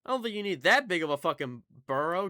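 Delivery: real exasperation at the mob; exasperated outburst. Real, not acted. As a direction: complain loudly in disbelief. Exasperated at creature's huge burrow.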